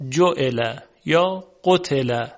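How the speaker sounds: جعل یا قتل said slowly and carefully; slow demonstration. Shown as a demonstration. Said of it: "توجه کنید". (آهسته و شمرده)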